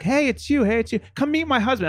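high, excited greeting; excited fangirl. Retold or imitated. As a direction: squeal it like meeting your idol. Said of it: "she's like".

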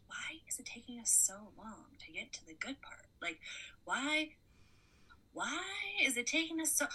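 exasperated whisper; hushed, impatient complaint; whispering impatiently. Retold or imitated. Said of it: "I'm like".

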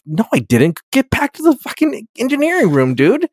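high-pitched, exasperated telling-off; mock irritated rebuke. Captain sends crewwoman back to engineering, mock irritated. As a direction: play an indignant captain sending someone away.

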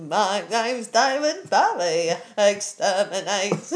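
slow, shouting Dalek voice; Dalek [name]. Pretend. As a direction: bark out exterminate like a Dalek. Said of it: "He sounds like a Dalek".

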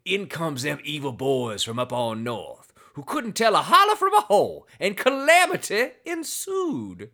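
a mock folksy Southern tale-teller; Southern accent. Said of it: "you can't do a Southern accent".

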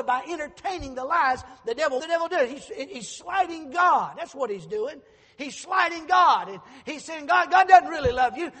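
shouted, ringing preacher's cry; preaching at full force. Acted. Pastor thundering at the congregation, shouting. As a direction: preach it out loud to the whole church.

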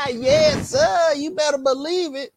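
high, gleeful brag; triumphant boast. Real, not acted. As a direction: crow with glee.

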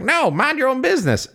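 high-pitched mock refusal; skinny little hobo. Hobo refusing Arthur, high indignant voice. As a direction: snub him in a high put-on voice. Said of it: "like, a skinny little hobo".

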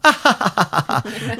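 high put-on cackle for a teaser; mock laugh. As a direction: fake Lisa's laugh, light and high. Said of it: "And have Lisa go".

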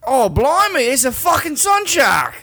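loud, high Australian yell of blimey; Australian accent, shouted with glee. Shown as a demonstration. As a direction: bellow it like an Aussie bloke. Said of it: "Could you say".

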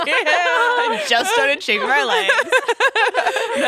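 high, slow laughing confession. Real, not acted. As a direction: laugh through it slowly. Laughing confession about shaving legs.

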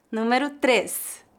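ordinary talk, nothing marked

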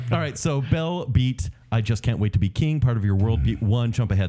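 normal talking voice

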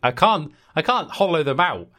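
normal talking voice

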